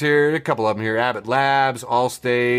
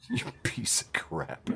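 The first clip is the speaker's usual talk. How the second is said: much softer, laughing jab; laughing under-breath insult; 13 dB quieter than usual. Real, not acted. Host jokingly insulting Ted Cruz, laughing. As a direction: mutter it through laughter.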